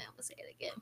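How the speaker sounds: breathy aside whisper. Real, not acted. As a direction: whisper it under your breath.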